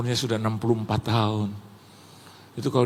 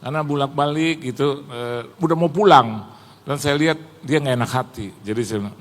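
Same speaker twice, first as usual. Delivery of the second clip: usual speaking voice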